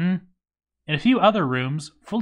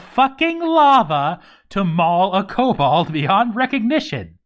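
much higher and louder, slowing on the punchlines; amazed, rising excitement; 9 semitones higher, 4 dB louder than usual. Real, not acted. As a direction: marvel out loud at the crazy feat. Amazed by a champion running through lava.

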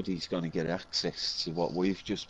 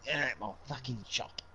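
rude shopkeeper; 6 semitones higher than usual. Retold or imitated. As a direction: bark it like a shopkeeper throwing you out. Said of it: "all you get is a round of fucks".

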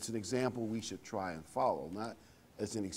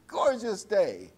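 delighted; 8 semitones higher, 5 dB louder, slower than usual. Real, not acted. Admiring the weather outside.

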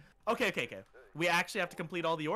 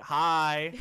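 ugly hi; 7 dB louder than usual. Real, not acted. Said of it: "That was the ugliest hi ever".

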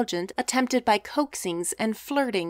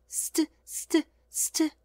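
higher, a disapproving tsk tsk; tut-tut in disapproval, clucking the tongue; deploring tut-tut; 8 semitones higher, much slower than usual. A narrator's reading. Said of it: "she deplored".

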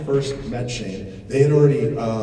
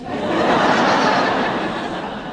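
loud laugh; 6 dB louder than usual. Real, not acted. Laugh after retold line.

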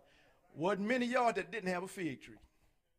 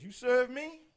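higher, louder promising voice; God promising; 6 semitones higher, 4 dB louder than usual. Retold or imitated. God promising his servants, rising and proud. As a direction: proclaim it as God's promise. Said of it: "God is saying this".

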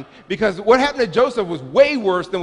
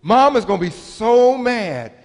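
a high, gloating "ooh" from a smug big brother; sing-song older-brother taunt, high; 4 semitones higher, 3 dB louder, slower than usual. Retold or imitated. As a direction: tease like a kid who knows you're in trouble. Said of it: "and he said".

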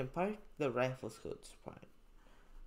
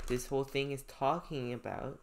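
ordinary delivery

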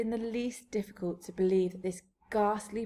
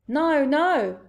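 high, loud, pleading denial; anxious cry; 7 semitones higher, 9 dB louder than usual. A narrator's reading. BFG denying the accusation, anxious high cry. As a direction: cry out in protest. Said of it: "cried the B F G".

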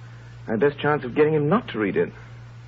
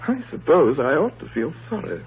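usual speaking voice

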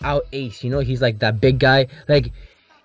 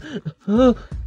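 mock gasp; 8 semitones higher than usual. Team panics on the bus.

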